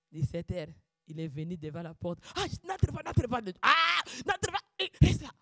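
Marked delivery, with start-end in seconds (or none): 2.3-5.3 a touch of mock alarm, higher; pleading at the door, half-acted; retold panic. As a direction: shoo him off in a mock fluster. Retold scene, blocking fiancé at door.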